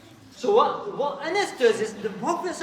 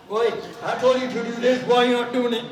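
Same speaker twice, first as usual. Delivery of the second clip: mock angry dad; 8 dB louder than usual. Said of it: "If your dad shouted at you, you say".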